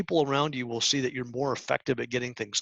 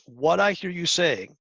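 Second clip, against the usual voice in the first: model phrase; 4 semitones higher, slower than usual. Shown as a demonstration. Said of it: "it starts with this phrase".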